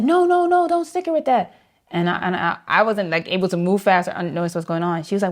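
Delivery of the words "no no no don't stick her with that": very high, loud mock-alarmed intern; hammed-up frantic objection; imitated urgent protest. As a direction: leap in to stop someone, voice shooting up. Imitates intern stopping the nurse.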